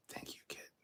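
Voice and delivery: soft whispered thanks; whispered thanks to a viewer; whispered aside. Real, not acted. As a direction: breathe the thanks under your voice.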